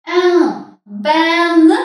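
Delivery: en, ben said as separate syllables, the N sounded clearly; sounding out the hidden N. Shown as a demonstration. Teacher isolates the hidden N sound for learners. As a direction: break the word apart to let the N ring out. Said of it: "Тут схована у нас літерка Н".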